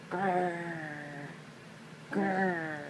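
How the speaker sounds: soft rolling growl; bear growl. Pretend. Parent showing what sound bear makes, soft growl. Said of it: "What sound does bear make?".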